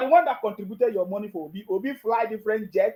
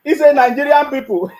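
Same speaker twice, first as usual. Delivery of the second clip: loud laughing mockery of the slogan; mocking laugh; 3 semitones higher, 8 dB louder than usual. Real, not acted. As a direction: scoff and laugh at it.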